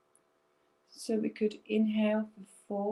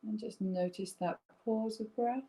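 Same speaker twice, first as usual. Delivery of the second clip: guided breathing meditation; 2 semitones lower than usual. Acted. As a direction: lead them gently through each breath.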